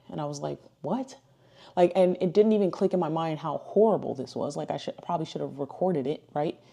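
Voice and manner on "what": high, soft, startled "what"; quietly taken aback, pitched up; retold disbelief. Retold shocked reply to odd revelation.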